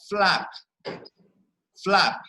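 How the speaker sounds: flap, said as one stressed syllable; one-syllable word said clearly. Shown as a demonstration. Teacher stressing flap as one syllable for the class. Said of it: "there is only one syllable".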